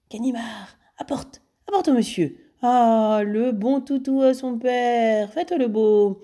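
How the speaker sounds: high, slow sing-song dog-owner voice; talking to a dog. Acted. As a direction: coax him like a pet poodle. Lupin parle à un chien. (voix haute et chantante pour un toutou)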